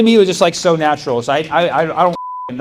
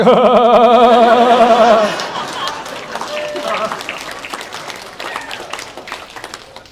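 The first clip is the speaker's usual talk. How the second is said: long, loud, high laughing fit; 9 semitones higher, 7 dB louder than usual. Real, not acted. Comic reliving her triumph, long loud laughter.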